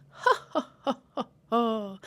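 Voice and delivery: spaced-out mock belly laugh; imitated jolly old laugh. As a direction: mimic a jolly old man's chuckle. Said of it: "Like a jolly old laugh".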